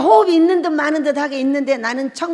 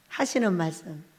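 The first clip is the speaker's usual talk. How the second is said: usual speaking voice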